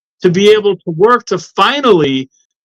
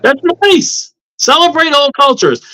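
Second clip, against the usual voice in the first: mock cheerful exclamations, much higher; sarcastic cheer; 8 semitones higher than usual. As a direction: chirp it with fake, over-the-top enthusiasm. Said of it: "And I say to that".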